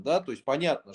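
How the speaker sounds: normal talking voice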